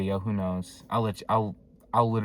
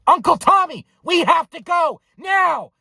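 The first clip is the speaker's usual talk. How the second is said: exasperated shout; 9 dB louder than usual. Retold or imitated. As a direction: yell each word at someone not listening. Shouting at uncle who didn't listen.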